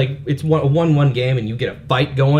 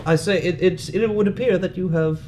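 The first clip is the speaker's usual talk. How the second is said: polite English; 3 semitones higher, 5 dB quieter than usual. Retold or imitated. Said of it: "if hockey was an English sport".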